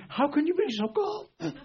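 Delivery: high, laughing squeal of disbelief; frantic store owner. Retold or imitated. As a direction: mimic the panicked owner through a laugh. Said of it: "she's going ballistic".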